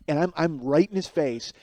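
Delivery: usual speaking voice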